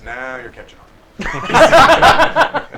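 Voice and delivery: slow, drawn-out approval; wry gentleman. Acted. Gentleman in a suit approving the bandit, slow and wry. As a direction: stretch it out wryly.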